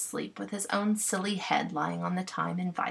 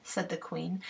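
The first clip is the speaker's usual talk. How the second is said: ordinary delivery, nothing marked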